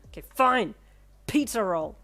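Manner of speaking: loud grudging yell; hollered back. Acted. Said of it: "he hollered back".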